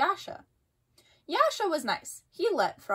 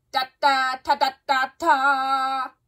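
louder, sung fanfare; sung bugle call; 5 dB louder, slower than usual. A narrator's reading. Bugle calling campers to the race, brassy. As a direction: toot it like a bugle. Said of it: "the bugle blasted".

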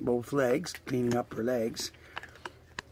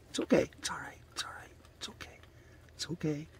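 soft whispered reassurance, fading softer; soothing whisper; 3 semitones higher, 9 dB quieter, faster than usual. Real, not acted. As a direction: hush them gently so they stay calm. Soothing the raccoons.

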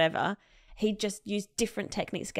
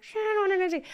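high panicked yelp; same frantic squeak, now on the spot; 13 semitones higher, much faster than usual. Retold or imitated. Player told he's on, high panicked squeak.

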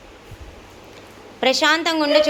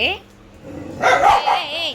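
sharp shout; 4 semitones higher, much slower than usual. Real, not acted. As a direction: stop them with a shout. Shouting at fighting pets.

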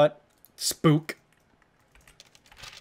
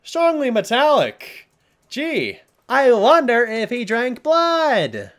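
slow, high sarcastic drawl; mock the writer's stupidity; 10 semitones higher, 6 dB louder, much slower than usual. Real, not acted. Sneering at the note writer, slow and sarcastic.

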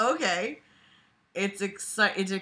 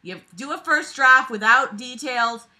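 normal talking voice

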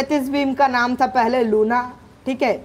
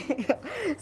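short laugh; 5 semitones higher, 9 dB quieter than usual. Real, not acted. Laughs after being killed.

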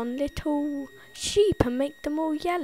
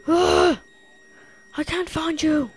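mock panic; 6 semitones higher, 6 dB louder than usual. Gasping panic searching for coal.

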